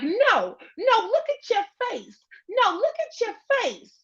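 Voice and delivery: high, frantic yell at Felicia; Debbie from Friday. Retold or imitated. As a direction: scream it like Debbie pulling Felicia up. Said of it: "I feel like Debbie in Friday".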